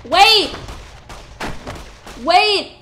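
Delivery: loud, high yelled wait; urgent shout. Real, not acted. Player shouting wait as a fight goes wrong, loud. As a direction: cry out to halt the fight. Sudden panic in combat.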